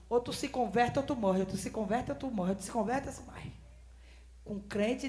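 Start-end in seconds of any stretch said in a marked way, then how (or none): 0.1-3.5 softer haunting repetition, fading; echoing voice. Retold or imitated. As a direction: let the warning echo and fade away. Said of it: "Aquela voz". (voz ecoando baixinho)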